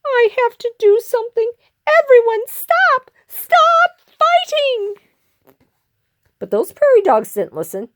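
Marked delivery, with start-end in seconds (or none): 0.0-4.9 very high, squeaky plea building to a cry; desperate little hero. Acted. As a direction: beg the whole crowd to stop. Small hero tries to stop fight.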